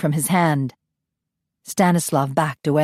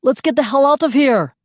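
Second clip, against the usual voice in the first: desperate yell; 9 semitones higher, 5 dB louder than usual. A narrator's reading. Captive yelling to escape.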